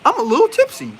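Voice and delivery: high, giggly tipsy voice; tipsy woman voice. Retold or imitated. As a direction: squeak it out like a tipsy girl. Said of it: "a woman even mentions".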